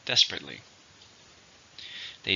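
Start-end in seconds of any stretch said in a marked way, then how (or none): 0.1-0.6 "Desperately" with DES stressed; stress on first syllable. Shown as a demonstration. Teacher models the adverb for learners, "Desperately" stressed on the first syllable. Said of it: "stress is in the first".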